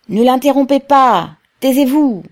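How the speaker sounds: high, loud retort; shouted rebuke. A narrator's reading. Voices from various sides defend Pavel, shouting to silence the heckler.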